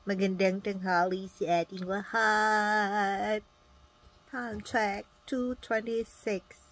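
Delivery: slow, formal radio host read; mock broadcast time check; announcer greeting. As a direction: greet everyone like a DJ.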